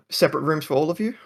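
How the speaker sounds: high, fast, bright asking; chirpy question. Acted. Halfling woman asking the guests about rooms, high and quick. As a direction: rattle off the question cheerfully.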